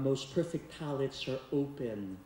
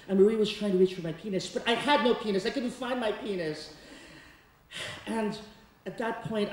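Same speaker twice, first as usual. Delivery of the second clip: ordinary talk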